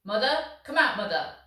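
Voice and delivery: loud, high call across the yard; a father's loud rising call; shouting. Acted. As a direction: bellow for her from outside. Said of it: "he shouted".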